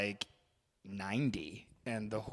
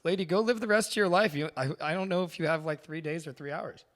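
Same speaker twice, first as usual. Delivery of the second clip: mock pleading; 6 semitones higher than usual. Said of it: "I was like".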